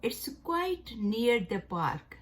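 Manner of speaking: "It's quite near the park" read very slowly; teacher reading the example sentence. Shown as a demonstration. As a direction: dictate it slowly. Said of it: "इसके जुमले हैं".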